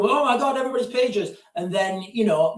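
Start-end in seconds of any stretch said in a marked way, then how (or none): 0.0-1.4 higher, hammed-up alarm; imitating people freaking out over pagers; mock panic. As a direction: play a panicked bystander, half-joking. Voicing panicking public.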